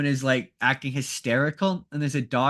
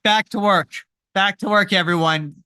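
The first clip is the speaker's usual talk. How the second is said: mock captain's order; 4 semitones higher, 6 dB louder than usual. Kirk hurrying crew after wedding.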